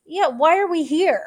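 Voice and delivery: playing a baffled soldier who wants out; exasperated Russian soldier. Russian soldier asking why they're fighting, exasperated and loud. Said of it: "your response to that is".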